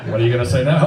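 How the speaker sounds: usual speaking voice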